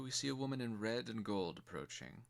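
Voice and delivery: ordinary delivery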